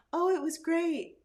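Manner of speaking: high, slow sing-song cheer; falsely cheerful patient. Retold or imitated. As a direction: chirp it slowly and brightly, hiding pain.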